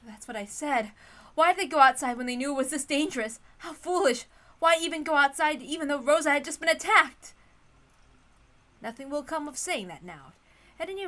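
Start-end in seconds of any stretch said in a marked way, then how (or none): 0.1-7.3 loud, high exasperation, a bit less forced; indignant retake. Acted. Natsuhi blaming the reckless group, exasperated and loud. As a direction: scold the absent group sharply. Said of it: "That's probably Natsuhi's line".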